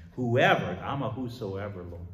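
ordinary delivery

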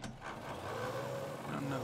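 slow, whispered mutter under the breath; mumbled aside. Real, not acted. Gamer mutters to himself over his car collection, slow whisper.

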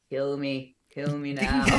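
low, soft attempt at a Scottish Shrek; poor Scottish accent. Actor imitating an exhausted Shrek between shows, in a hesitant Scottish accent. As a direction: lightly mimic a worn-out Shrek with a rough Scottish accent. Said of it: "I can't do a very good Scottish accent".

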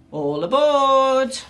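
loud, high, long-drawn call. A narrator's reading. Captain summons new crew onto the ship, calling out. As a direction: holler it slowly to the whole crew.

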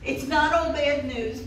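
usual speaking voice

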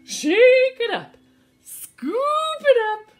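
high, loud action shouts; excited calls. Acted. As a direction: call out each action. Calls all actions in a row.

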